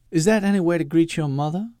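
high, sharp scolding snap; snapped rebuke. A narrator's reading. Mother scolding her son, sharp snap. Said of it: "Iphigenia snapped".

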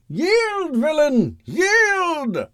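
very high, strained heroic cry; superhero demand. Acted. Hero wrenches the villain's arm, high commanding cry.

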